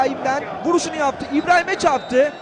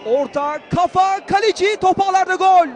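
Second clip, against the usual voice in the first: quick calls rising higher and louder; excited goal call; 5 semitones higher, 5 dB louder than usual. Real, not acted. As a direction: snap each word, then call the goal. Quick cross, header, goal.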